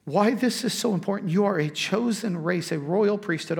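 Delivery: ordinary delivery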